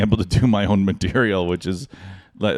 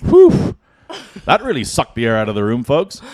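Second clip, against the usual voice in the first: imagined emcee quip; 3 semitones higher, 6 dB louder than usual. Retold or imitated. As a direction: play a tactless host addressing folks. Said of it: "I went up and said".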